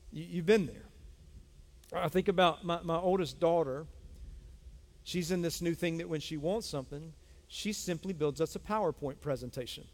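usual speaking voice

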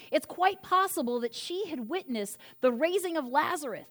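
ordinary talk, nothing marked

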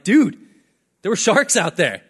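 telling the local friend there were sharks, rattled and loud; rattled outburst. Retold or imitated. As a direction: burst out shaken to a friend. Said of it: "I'm rattled".

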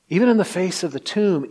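ordinary talk

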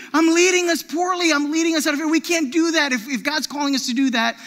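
usual speaking voice

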